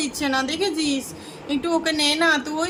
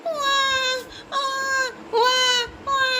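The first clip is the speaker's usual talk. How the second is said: put-on baby crying wail; 9 semitones higher than usual.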